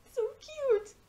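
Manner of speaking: very high, adoring squeal; gushing squeal. Real, not acted. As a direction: melt over how cute it is. Gushing over cute scene.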